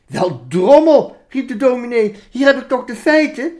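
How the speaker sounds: exasperated exclamation. Acted. Minister exclaiming at the sheep, hammed-up exasperation.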